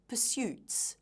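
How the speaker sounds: "pursuits" with the stress on "suits"; weak "pur", stronger "SUITS" in "pursuits"; second-syllable stress. Shown as a demonstration. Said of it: "the stress is on the second syllable".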